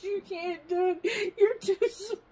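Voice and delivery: high, laughing-crying protest; half laughing, half crying. Retold or imitated. Telling her small husband he can't lift her, laughing.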